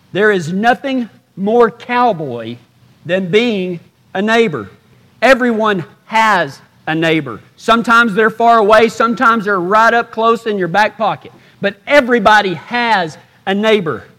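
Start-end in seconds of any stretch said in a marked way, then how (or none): none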